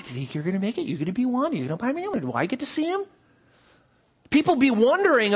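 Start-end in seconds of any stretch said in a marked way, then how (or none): 0.0-3.0 softer, hushed eager questions between friends; excited chatter. Retold or imitated. Preacher voicing people whispering about the rapture, softer and eager. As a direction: murmur the questions as if gossiping in the pews. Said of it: "They'd be talking".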